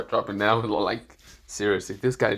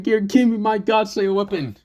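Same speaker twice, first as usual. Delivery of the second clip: loud, high cry for a weapon; demanding yell; 9 semitones higher, 6 dB louder than usual. Acted. Hero demanding his weapon from his gear, loud yell.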